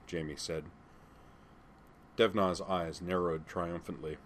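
ordinary delivery

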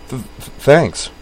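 nervous stammer on the first sounds; stammering girl. Acted. Kate answering the customs officer, stammering. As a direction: trip over the words, flustered.

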